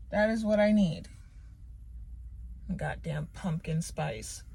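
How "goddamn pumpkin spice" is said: slow, grumbling under the breath; annoyed mutter. Real, not acted. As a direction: curse it quietly, fed up. Grumbles about unwanted coffee.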